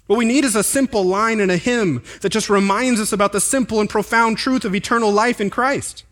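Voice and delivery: preaching. Real, not acted. Pastor urges congregation toward simple truth, preaching. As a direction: press the point home to the room.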